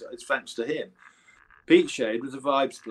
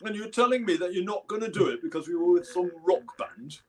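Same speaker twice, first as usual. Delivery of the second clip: higher, louder mock-indignant impersonation; 6 semitones higher, 5 dB louder than usual. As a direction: act out the affronted bandleader, hammed up. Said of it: "And he went".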